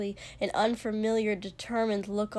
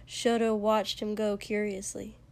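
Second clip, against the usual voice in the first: ordinary delivery, nothing marked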